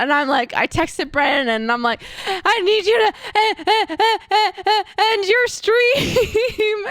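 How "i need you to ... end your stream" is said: high, stammering, slow mock sobbing; retold crying. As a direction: reenact blubbering through tears, pitched up. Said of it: "just crying my eyes out".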